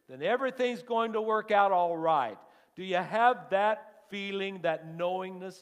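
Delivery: soaring, loud preacher's climb; preaching at full force. Real, not acted. Preacher urging the congregation, loud and rising. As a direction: lift the congregation to a shout.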